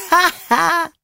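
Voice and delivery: high, gleeful mock laugh; a chorus of delighted critters laughing; animals laughing. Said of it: "laughed all the animals".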